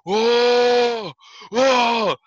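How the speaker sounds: high, loud startled whoa, drawn out and falling; shocked whoa. Retold or imitated. Friends see the bloody head wound, a loud shocked whoa. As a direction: gasp it out like you just saw blood.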